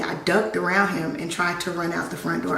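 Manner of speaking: ordinary talk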